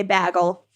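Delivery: "bagel" said as "baggle"; first vowel of "bagel" as in "bag"; Buffalo bagel. Shown as a demonstration. Co-host quoting people in Buffalo, "bagel" as "baggle". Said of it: "people in Buffalo say".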